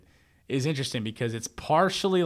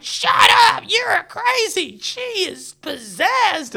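high, loud mock shouting match; yelling back; 10 dB louder, much slower than usual. Dog owners shouting back at the woman in the yard, yelling. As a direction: imitate outraged neighbors, stretching the words out slowly. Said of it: "the other people are like".

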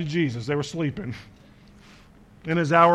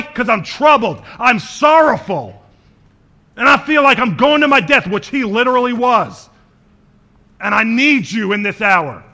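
loud, higher-pitched preaching with hard-hit phrases; impassioned, raised sermon voice; full-force preaching; 6 semitones higher, 9 dB louder than usual. Real, not acted. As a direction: preach it out to the whole congregation.

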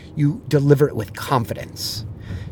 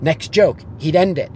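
ordinary talk, nothing marked

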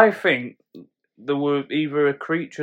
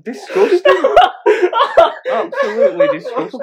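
high-pitched, helpless laughing; laughing mock disgust; 8 dB louder than usual. Real, not acted. Friend reacting to the teasing, laughing hard. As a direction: squeal it out through laughter.

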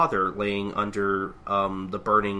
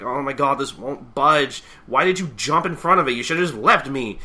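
higher, louder, desperate pleading; panicked plea; 6 semitones higher, 8 dB louder than usual. Retold or imitated. Tatsumi panicking to the fire chief, higher, louder plea. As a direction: beg him, half in tears, voice pitched up. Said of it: "she's like".

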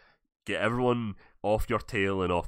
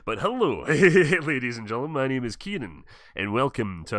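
host intro; 3 semitones higher, 4 dB louder than usual. Acted. Redoing the show intro.

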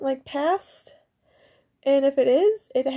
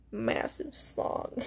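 very low, slow, breathy joke whisper; a hammed-up low whisper of the punchline; mock deep whisper; 12 dB quieter, much slower than usual. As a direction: drop way down and whisper it for the gag. Jokingly renaming the penis.

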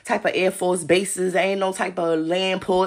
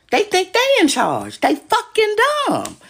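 high exasperated outburst; 12 semitones higher, 5 dB louder than usual. Real, not acted. Ranting to her viewers, high and loud in exasperation. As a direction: voice jumps high and loud in disbelief. Ranting that they think they're in charge.

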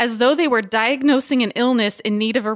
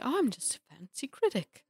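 a light posh British put-on; mock British accent; 11 dB quieter than usual. Host mocking critics, in a soft British accent. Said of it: "I don't know why I've become British".

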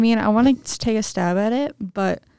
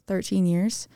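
ordinary talk

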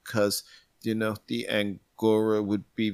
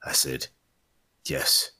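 low, hard-boiled narration; noir detective; 8 semitones lower, 3 dB quieter than usual. Acted. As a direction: narrate like a film noir gumshoe.

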